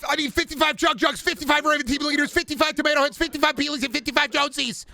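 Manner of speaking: high-pitched frantic gamer voice; Connor O'Malley impression. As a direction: rattle off the list in a high, excited voice. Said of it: "Connor O'Malley doing a Fortnite Let's Play".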